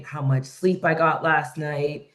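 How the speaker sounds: usual speaking voice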